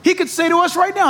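usual speaking voice

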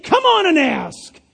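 loud, high, drawn-out plea; slow, insistent coaxing shout; Jesus urging. Acted. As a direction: call out an invitation, stretching the words. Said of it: "He's saying".

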